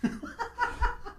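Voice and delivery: high gleeful cackle; squealing mock-evil cackle; cackling laugh. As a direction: laugh like a triumphant villain. Gloating after balcony yell.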